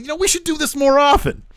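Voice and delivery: very high, chirpy imitation; eager suggestion. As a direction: pitch it way up like an eager hanger-on. Acting eager Sami talking to Roman.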